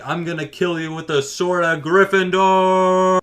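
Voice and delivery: high, loud, drawn-out hero's threat; mock battle cry. Boy charging at Wizard Hitler with a sword, mock battle cry. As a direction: declaim it slowly and loudly, stretching the last word. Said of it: "it's just like".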